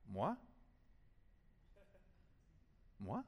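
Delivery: Moi? said the French way, mwa, with a rising tone. Shown as a demonstration. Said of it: "it's what the French say".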